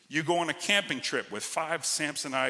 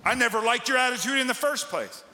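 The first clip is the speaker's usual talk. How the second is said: higher, indignant mock dismissal of someone; huffy brush-off; 8 semitones higher than usual. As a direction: play the offended quitter, sniffy and dismissive. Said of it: "you say".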